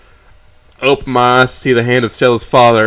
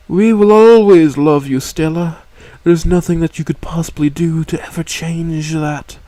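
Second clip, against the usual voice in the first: cooing; 5 semitones higher than usual. A narrator's reading. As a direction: reassure her daughter gently. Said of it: "she cooed".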